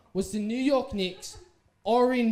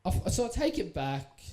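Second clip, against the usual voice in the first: ordinary delivery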